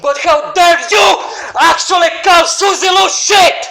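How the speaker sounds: yelling in fury at the rival YouTuber; furious shout. Real, not acted. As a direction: explode at him, full-throated.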